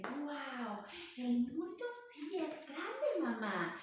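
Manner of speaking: put-on high, slow and wide-eyed, a child's wonder; amazed baby kangaroo. Baby kangaroo peeking out to mama, amazed. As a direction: marvel at the big world like a little joey. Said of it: "cangurito se asoma por la bolsa y dice".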